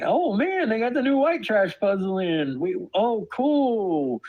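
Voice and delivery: high-pitched, hammed-up delight; mock enthusiasm, pitched well up; mock-excited shopper. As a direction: play a thrilled shopper finding a new puzzle. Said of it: "you go to the store and you".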